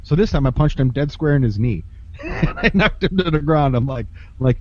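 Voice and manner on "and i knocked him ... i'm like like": ordinary talk, nothing marked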